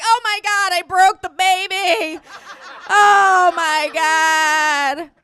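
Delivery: loud, high, drawn-out wail; hammed-up horror; mock panic. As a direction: wail it like a horrified parent. Mock panic at scarring the child.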